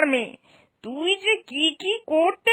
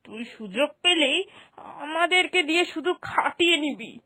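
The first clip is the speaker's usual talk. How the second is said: ordinary delivery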